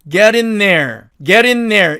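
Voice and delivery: getting there said as get in there; native reduction. Shown as a demonstration. Teacher shows students the eased native form. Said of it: "이렇게 발음을 합니다".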